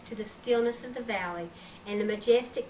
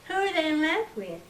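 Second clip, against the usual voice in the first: wide-eyed wonder; 6 semitones higher than usual. A narrator's reading. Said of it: "wide-eyed".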